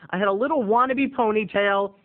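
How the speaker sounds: ordinary delivery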